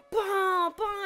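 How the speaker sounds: loud, high blaring alarm noise; imitated alarms blaring. Teller showing many alarms going off, loud imitated blare. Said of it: "like a bunch of alarms are going off".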